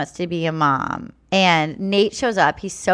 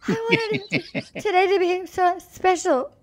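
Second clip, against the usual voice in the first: very high mock crying voice; exaggerated tearful impersonation, pitched way up; Gabby sobbing; 11 semitones higher than usual. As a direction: squeak it out as a hammed-up weeper. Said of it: "Like this, ready?".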